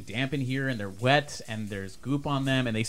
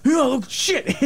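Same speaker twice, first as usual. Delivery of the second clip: high, loud frightened curse; really scared; 5 dB louder than usual. Acted. Scared survivor struggles to free a victim, frightened. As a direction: yelp it in fright. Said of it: "This guy must be really scared".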